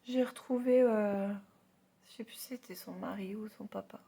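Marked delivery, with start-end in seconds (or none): 2.1-4.0 whispered aside. Real, not acted. Aside while telling the story.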